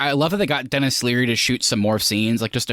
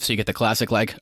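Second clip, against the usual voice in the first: normal talking voice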